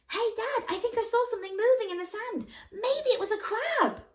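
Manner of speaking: put-on high, fast eager kid; excited child. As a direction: call out to Dad like an excited boy. Jack excitedly calling his dad.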